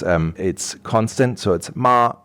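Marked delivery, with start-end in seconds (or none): 1.8-2.1 "ma" held level and steady, a bit above normal pitch; even flat "ma" in first tone; Mandarin first tone. Shown as a demonstration. Teacher demonstrating first tone of ma for the interviewer, level. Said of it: "the first tone is a tone that is slightly above your regular speaking level, but it's constant".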